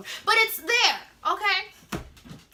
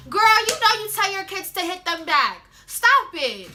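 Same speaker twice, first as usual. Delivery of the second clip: louder, teasing callout; loud calling out; 4 semitones higher, 6 dB louder than usual. Retold or imitated. Student calling out the Trinidadian bus monitor, loud and teasing. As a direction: call her bluff.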